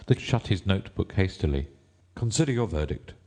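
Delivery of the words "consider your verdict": ordinary delivery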